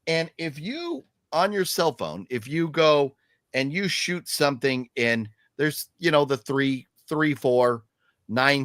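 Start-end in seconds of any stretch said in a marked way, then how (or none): none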